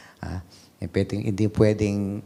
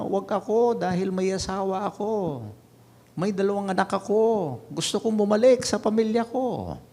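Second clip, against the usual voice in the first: much higher, pleading cry; desperate plea; 12 semitones higher than usual. Retold or imitated. Sergeant begging the officer to spare him, high desperate plea. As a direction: beg for your life to go home to your family. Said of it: "he was, uh, crying out loud".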